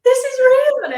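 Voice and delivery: high, building to a loud joyful cry; overwhelmed with joy at a live show; retold rapture. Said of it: "I was like".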